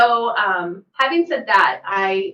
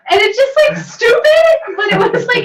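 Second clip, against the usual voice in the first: shouting through real laughter, much higher and louder; laughing; 13 semitones higher, 9 dB louder than usual. Real, not acted. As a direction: crack up and half-shout the words. Laughing at son's punchline.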